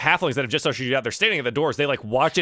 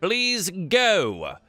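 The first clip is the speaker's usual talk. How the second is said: higher-pitched, urging halfling ushering someone out; officious bouncer; 6 semitones higher, slower than usual. Acted. Halflings ushering a patron out of the bar, officious. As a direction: shoo them out in a raised, put-on voice.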